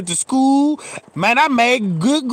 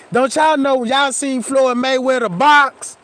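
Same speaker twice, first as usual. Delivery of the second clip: ordinary delivery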